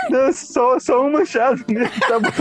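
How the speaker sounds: high, strained pleading voice; exasperated customer. Retold or imitated. (cliente exasperado)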